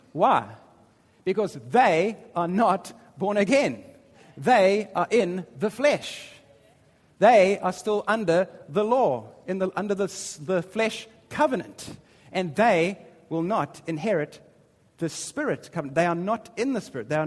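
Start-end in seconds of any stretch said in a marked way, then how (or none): 0.1-11.9 forceful sermon delivery; preaching with force. Acted. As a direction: press the point home to the congregation.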